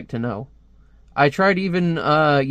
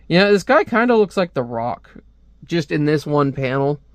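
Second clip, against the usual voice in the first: ordinary talk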